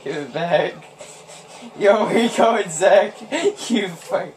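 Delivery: ordinary talk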